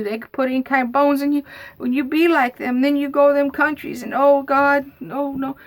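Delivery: high, emphatic mock dismay; worried going-on. Aunt imagining foreign bones in the speaker, worked-up worried rant. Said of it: "And then she goes on".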